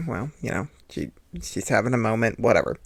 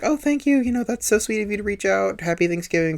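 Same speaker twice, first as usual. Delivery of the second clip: much higher, sugary imitation of her; sugary mock reply; 8 semitones higher than usual. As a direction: mimic her overly sweet thank-you, pitched way up. Quoting her sweet reply.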